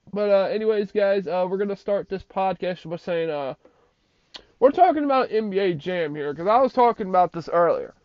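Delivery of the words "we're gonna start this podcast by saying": ordinary talk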